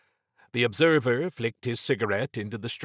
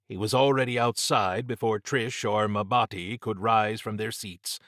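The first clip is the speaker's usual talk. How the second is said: ordinary delivery, nothing marked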